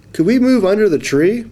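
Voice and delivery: high polite fish request. Acted. Fish asking to move to the shade, high and polite. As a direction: ask nicely in a tiny fish voice. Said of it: "it asked".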